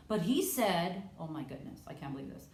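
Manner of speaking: normal talking voice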